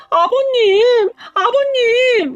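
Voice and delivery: high, very slow wailing cry. Acted. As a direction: sob his name out in despair. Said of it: "대성통곡을 하고 울었어요". (통곡하며 시아버지를 부르는 울음)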